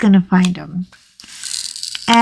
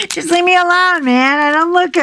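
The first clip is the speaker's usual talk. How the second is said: playful mock grump; 8 semitones higher, faster than usual. Playful repeat, higher.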